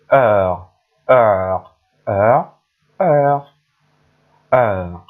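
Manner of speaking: heure said with silent h and a French r. Shown as a demonstration. Teacher modelling heure for students. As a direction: model the French word heure, clear and loud. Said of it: "we have".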